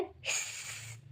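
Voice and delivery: long unvoiced hiss; snake hiss. Shown as a demonstration. Teacher hisses like a snake for students. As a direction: let the hiss drag out slowly like a snake. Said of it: "snake क्या कहता है".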